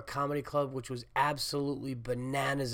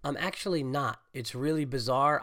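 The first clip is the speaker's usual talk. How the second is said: normal talking voice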